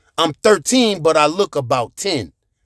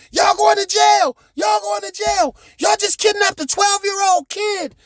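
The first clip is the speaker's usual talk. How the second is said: screaming threats; 14 semitones higher than usual. Retold or imitated. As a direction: scream at the kidnappers that they're going to jail. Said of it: "So I start screaming".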